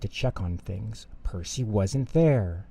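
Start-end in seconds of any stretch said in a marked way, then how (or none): none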